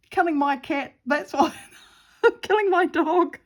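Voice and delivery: high, loud, giggly outburst; laughing accusation. Real, not acted. Joking about a celebrity's supposed crime, laughing. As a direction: blurt it out through laughter.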